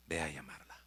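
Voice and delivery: hushed whisper; whispered order. Acted. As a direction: whisper the command softly. Princess gives quiet order.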